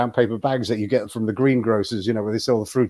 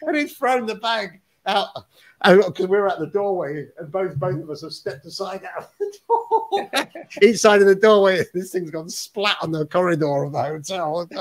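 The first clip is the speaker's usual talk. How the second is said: laughing through the story; 5 semitones higher than usual. Real, not acted. Laughing while telling gross story.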